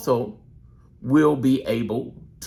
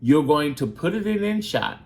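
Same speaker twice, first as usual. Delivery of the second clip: ordinary talk, nothing marked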